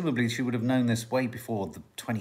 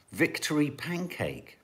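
ordinary delivery

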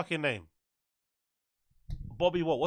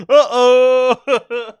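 louder, high meme uh-oh; 5 semitones higher, 8 dB louder than usual. Acted. Doing a meme catchphrase for chat, loud uh-oh. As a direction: belt the uh-oh like a sound drop.

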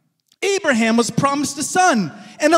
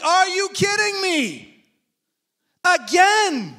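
exasperated disbelief; 6 semitones higher, slower than usual. Retold or imitated. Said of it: "He was like".

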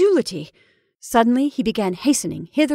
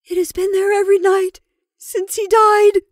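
high, loud wail of dread; frightened cry; 12 semitones higher, 8 dB louder than usual. Acted. Rebecca tells of the shadow every night, frightened cry. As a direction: cry it out in fear. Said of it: "cried Rebecca".

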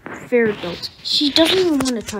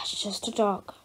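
breathy whisper under the breath; a parent whispering to herself about the scared child; whispered aside; 4 semitones lower, 7 dB quieter than usual. Acted. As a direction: whisper it so the kid won't hear. Parent aside while child panics.

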